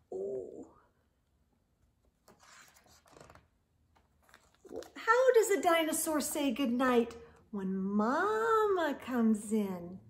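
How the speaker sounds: lively storybook read-aloud; expressive picture-book narration; reading to children. Acted. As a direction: read it to kids at bedtime, animated.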